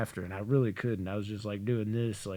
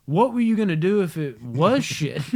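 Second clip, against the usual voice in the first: raised, loud retold challenge; incredulous brother; 6 semitones higher, 6 dB louder than usual. Brother confronting prankster over smudge, incredulous. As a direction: demand it in mock-outraged disbelief.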